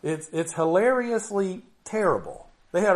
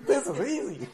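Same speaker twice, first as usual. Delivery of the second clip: laughing; 6 semitones higher than usual. Real, not acted.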